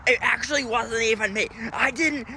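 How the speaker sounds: lightly voiced defensive kid; a hint of a whiny child denying blame; daughter denying. Dad imitating his daughter denying it, lightly whiny. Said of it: "the only thing I hear from her is".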